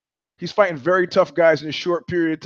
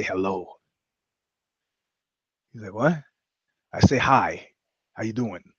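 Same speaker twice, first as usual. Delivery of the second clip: soft pickup line; 7 semitones lower, 6 dB quieter than usual. Shown as a demonstration. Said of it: "your favorite pickup line".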